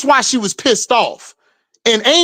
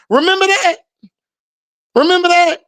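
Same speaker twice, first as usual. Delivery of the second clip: mock reminder; 6 semitones higher, faster than usual. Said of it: "mocking repeated question".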